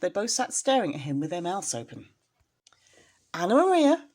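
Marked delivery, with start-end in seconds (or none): 3.3-4.1 higher, louder rat calling her; old rat calling. Acted. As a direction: call out to his wife. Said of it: "said the old man rat".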